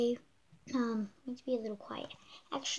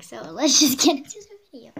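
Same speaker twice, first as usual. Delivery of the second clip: laughing through the line; 18 dB louder than usual. Real, not acted. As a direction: giggle and push through it. Retake with laugh.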